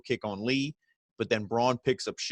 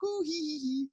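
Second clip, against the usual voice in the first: imitating a puppet's high giggle; 5 dB quieter than usual. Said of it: "And he's like".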